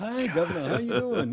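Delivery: put-on friendly greeting; sheepish greeting. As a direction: act casual after getting caught. Acting out a sheepish greeting to the governor.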